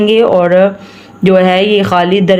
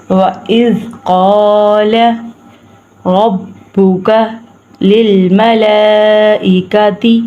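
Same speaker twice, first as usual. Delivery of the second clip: slow recitation demo; slower than usual. Shown as a demonstration. Teacher models the verse for students, slow recitation. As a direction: slowly recite each word for students. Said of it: "میرے ساتھ پڑھیں".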